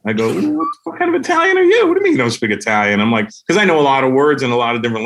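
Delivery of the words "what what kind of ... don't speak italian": rushed, mock-outraged questioning; acted incredulity. Comic confronting a New York Italian, incredulous. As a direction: start high, then hurry out the disbelief.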